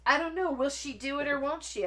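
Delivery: high, loud teasing question; mock suspense. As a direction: tease them with will-she-won't-she suspense. Playfully teasing viewers with suspense.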